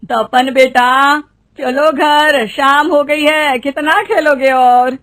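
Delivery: slow, drawn-out motherly call; calling child home. Acted. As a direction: stretch each phrase so it carries to the child. (धीरे-धीरे खींचकर पुकारना)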